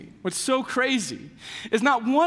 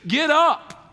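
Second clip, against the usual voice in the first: higher, louder command; punchy, raised-voice order; commanding call; 6 semitones higher, 6 dB louder than usual. Retold or imitated. Jesus commands dead Lazarus.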